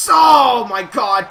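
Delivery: loud, drawn-out commentator's exclamation; stunned ringside outburst; shocked shout. Real, not acted. Shock at wrestling move in commentary.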